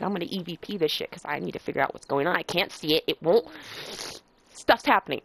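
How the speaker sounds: low, fast, soft muttering. Retold or imitated. Retold muttering to self.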